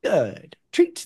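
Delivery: high, bright-sounding, loud, sing-song dog praise; perky princess voice. Shown as a demonstration. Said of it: "I make perky princess voice".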